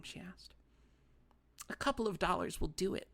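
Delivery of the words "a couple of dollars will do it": put-on higher, whispery petulant old woman; a wronged old lady sulking; fussy little old lady. A narrator's reading.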